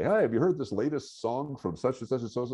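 high, bright-eyed hey, pitched well up; mock eager question. Professor retelling asking students about a song, mock eager. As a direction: play it as a keen fan sharing news. Retelling his own outdated enthusiasm.

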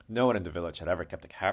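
ordinary delivery

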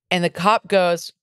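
ordinary delivery

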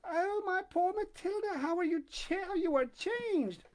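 high, slow cry of dismay; shocked lady. Acted. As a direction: gasp it out in pity. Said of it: "Her friend uttered a cry".

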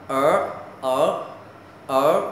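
"ở" dipping low then rising, with an r colour; tongue-curled Ở. Shown as a demonstration. Teacher models E R, tongue-curled rising "ở". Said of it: "Gọi là âm uốn lưỡi".

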